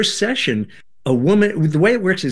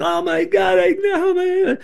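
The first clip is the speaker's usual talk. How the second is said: high wailing cry, much higher; retold wailing. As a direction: imitate her wailing at full pitch. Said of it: "then she's wailing".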